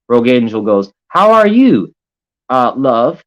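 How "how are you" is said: higher, louder singsong greeting; mock sweet voice. Streamer mocking Rogue Angel's greeting, high and louder. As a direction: play it up like a gushing old lady.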